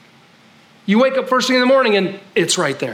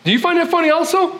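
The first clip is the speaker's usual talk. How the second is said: high, fast barking drill instructor; drill sergeant; 10 semitones higher, faster than usual. Retold or imitated. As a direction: fire the question like a drill instructor. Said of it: "He's like".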